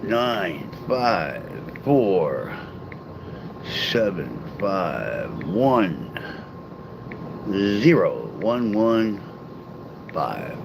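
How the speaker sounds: spelling out digits very slowly; slow, deliberate dictation of a phone number; slow number read. Real, not acted. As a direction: read each digit slow and separate so they can copy it.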